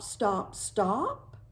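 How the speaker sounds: usual speaking voice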